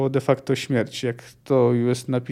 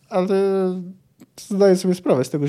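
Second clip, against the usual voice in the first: ordinary delivery, nothing marked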